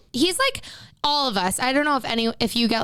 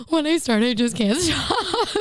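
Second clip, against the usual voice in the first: mildly hammed-up tearful wail; retold crying; 4 semitones higher than usual. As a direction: whine it through pretend tears. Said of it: "then I start crying even more".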